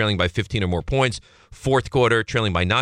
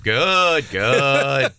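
loud, drawn-out, raised-pitch catchphrase; old deli man; 4 semitones higher, 3 dB louder than usual. Retold or imitated. Host imitating a favorite sound clip, old deli man voice. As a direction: stretch the word out big and high.

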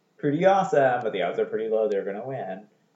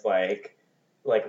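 ordinary talk, nothing marked

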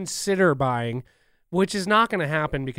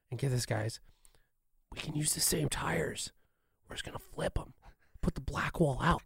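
hushed, scheming whisper; conspiratorial whisper; 9 dB quieter than usual. Pretend. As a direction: whisper it like a secret plan. Executives scheming in mock secret.

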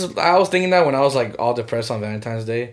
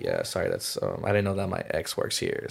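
low, quiet mock apology; sheepish apology; 8 semitones lower, 11 dB quieter than usual. Explaining an ex to the date, low sheepish apology. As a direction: drop it low and soft, excusing yourself.

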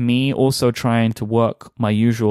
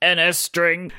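higher, robotic monotone read; computer voice; 7 semitones higher, slower than usual. Pretend. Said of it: "You read it in a computer voice".

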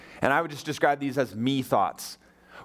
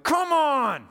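sharp high exasperated yell; frustrated outburst; 12 semitones higher, 3 dB louder than usual. Retold or imitated. Pastor retelling his stoplight impatience, mock exasperated yell. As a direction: burst out at the stuck van. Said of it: "I said out loud by myself in the car".

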